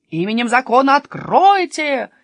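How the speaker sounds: loud, high official demand; shouted command. Acted. Bastian at the robbers' gate, shouting in law's name. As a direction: call out to be let in. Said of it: "крикнул Бастиан".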